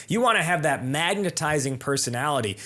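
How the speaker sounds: ordinary talk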